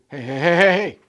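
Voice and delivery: loud warning call; stern Papa voice. Retold or imitated. Grandfather loudly stopping his toddler granddaughter. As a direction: stop a toddler in her tracks. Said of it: "the Papa voice came out".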